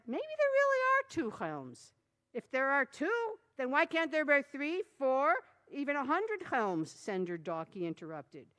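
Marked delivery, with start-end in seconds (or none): 0.1-6.9 high, dopey voice; dopey elder. A narrator's reading. Dopey elder speculating to the crowd. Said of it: "dopey likeish".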